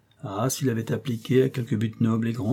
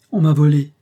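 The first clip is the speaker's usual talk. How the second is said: higher and louder, frightened; 5 semitones higher, 10 dB louder than usual. A narrator's reading. The countess cries out from the doorway, loud and panicked. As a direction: blurt it out too loud, panicking. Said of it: "elle parlait très haut".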